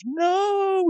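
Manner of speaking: very high stretched-out no; mock scream. GBF seeing his record beaten, high mock scream. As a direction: wail the no as you lose. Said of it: "he screams".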